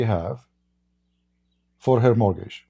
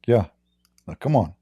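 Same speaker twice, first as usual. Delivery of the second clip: normal talking voice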